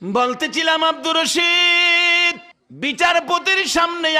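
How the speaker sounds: chanted storytelling with long-held high notes; melodic preaching. Real, not acted. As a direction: stretch out the names and pleas, sinking at the end.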